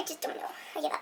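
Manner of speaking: breathy whispered aside; hushed mumble. Real, not acted. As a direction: barely voice it.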